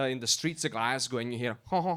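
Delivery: ordinary delivery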